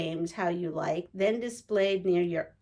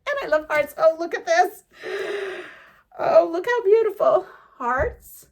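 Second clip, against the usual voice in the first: high, louder excited exclaiming; thrilled, raised voice; delighted gushing; 9 semitones higher, 5 dB louder than usual. Real, not acted. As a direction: gush over something beautiful. Thrilled at the fabrics.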